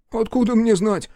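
high-pitched, puzzled protest; surprised. A narrator's reading. Young policeman asked where the robbers are, surprised. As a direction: answer up high, taken aback. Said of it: "удивился Малинкин".

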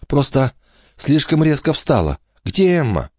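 put-on a girl forcing calm; girl's voice. Kate hides her faint.

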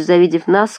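usual speaking voice

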